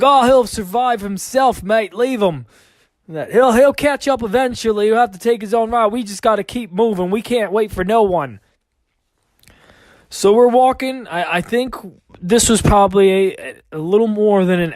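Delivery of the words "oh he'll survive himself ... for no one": higher, brisk mock Australian accent; lightly voiced Aussie leader, Australian twang. Aussie telling the group to leave stragglers, mock Australian accent. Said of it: "the Aussie was just like".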